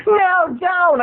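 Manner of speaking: high mock plea; imitating Laura. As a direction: beg it up high. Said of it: "Laura pleading".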